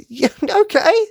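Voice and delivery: laughing at Kaiba's line; amused laugh. Real, not acted. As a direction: giggle it out in disbelief.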